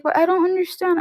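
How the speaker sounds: high-pitched mimicked upset child; about to cry. Little cousin not understanding the movie, retold as near tears. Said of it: "literally about to cry".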